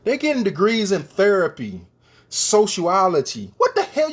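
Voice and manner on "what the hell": shoots up high in disbelief; a sudden high cry of exasperation; exasperated outburst. Real, not acted. Exasperated rant about useless degrees.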